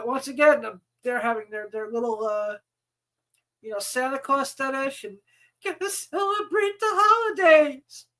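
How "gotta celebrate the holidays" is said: mocking voice. Mocking the organizer's holiday obsession.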